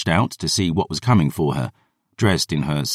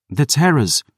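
higher young girl, lightly voiced; a hint of a young girl; girl naming the monsters, lightly voiced; girl's voice; 9 semitones higher than usual. Dior answers.

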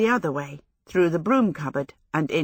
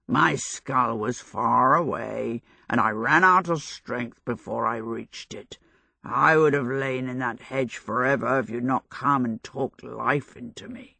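mushy scarecrow voice; 6 semitones lower than usual. A narrator's reading. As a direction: speak as if your mouth were full of damp straw. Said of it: "its mushy voice".

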